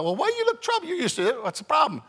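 high, light questioning wife; wife's voice. Retold or imitated. Wife asking her husband why he looks troubled. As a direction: voice the wife asking what's wrong.

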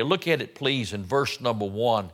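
ordinary talk

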